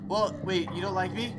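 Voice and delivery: normal talking voice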